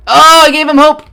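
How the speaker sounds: high, loud triumphant shout; gloating shout. Real, not acted. Gamer crowing loudly at the narrator. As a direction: crow it out in triumph.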